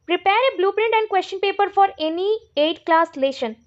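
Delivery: very slow, word by word, for students to write down; reading an exam question aloud at dictation pace. Shown as a demonstration. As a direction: dictate it slowly so they can copy.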